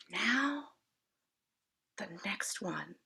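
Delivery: soft unvoiced whisper. A narrator's reading. An places the top block, whispering. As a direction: breathe it, careful not to topple. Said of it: "An whispered".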